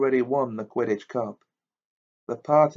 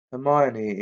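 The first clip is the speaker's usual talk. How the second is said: ordinary delivery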